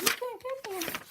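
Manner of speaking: very high squeaky character voice; high-pitched taunt. Acted. Sergeant behind a wall to attackers, squeaky taunt.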